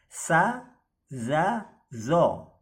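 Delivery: sa, za, zo with tongue tip out at the teeth; tongue between teeth. Shown as a demonstration. Teacher showing students the letter sounds. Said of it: "lidahnya sedikit keluar di ujung gigi".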